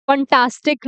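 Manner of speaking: "फंटास्टिक" with flat "uh" first vowel, phun-taastic; mispronunciation. Shown as a demonstration. Teacher rejecting students' version, "फंटास्टिक" mispronounced phun-taastic. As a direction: say "फंटास्टिक" wrongly as phun-TAAS-tik. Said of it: "नहीं बोलते".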